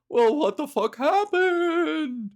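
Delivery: ghostly voice, loud, slow at the end; spooky ghost voice. Pretend. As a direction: play the dismayed ghost, stretching it out. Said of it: "And they're like".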